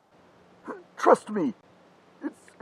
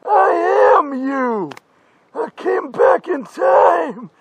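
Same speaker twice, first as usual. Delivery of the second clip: higher, louder, slow tearful declaration; dramatic crying shout; 6 semitones higher, 3 dB louder, slower than usual. Acted. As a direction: wail it out like a desperate prophet. Future self dramatic reveal.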